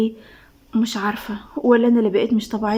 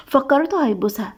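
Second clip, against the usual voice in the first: plain reading voice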